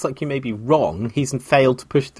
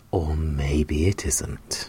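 low, slow ominous whisper; 8 semitones lower, 6 dB quieter, much slower than usual. Acted. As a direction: breathe it like a dark secret. Ominous half-joke, low whisper.

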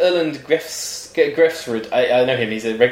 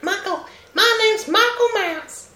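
[name] voice; slower than usual. Pretend. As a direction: put on a high [name] voice. [name] joke, high voice.

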